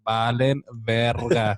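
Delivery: Valen verga said very slowly, each syllable clear; over enunciating. Shown as a demonstration. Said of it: "Let me say it slowly".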